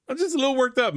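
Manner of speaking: high, keyed-up admission; worked up. Real, not acted. YouTuber admitting to viewers he's worked up, keyed-up. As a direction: confess being heated.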